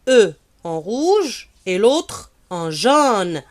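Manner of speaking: slow pointed "E en rouge et l'autre en jaune", stress on "rouge"; slow emphasis. Shown as a demonstration. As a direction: spell it out slowly to draw attention. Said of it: "Vous avez remarqué que j'ai écrit".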